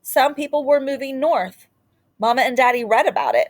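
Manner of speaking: ordinary delivery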